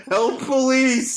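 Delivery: high, drawn-out cry for help; hammed-up distress call; mock yell for help. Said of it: "this woman's yelling for police".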